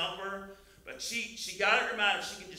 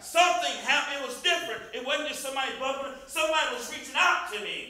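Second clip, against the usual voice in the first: loud, raised preacher's voice; forceful pulpit storytelling, high and loud; emphatic preaching; 7 semitones higher, 10 dB louder than usual. Acted. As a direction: press the point hard, acting out Jesus.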